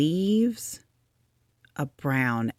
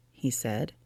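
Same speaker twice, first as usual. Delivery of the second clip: usual speaking voice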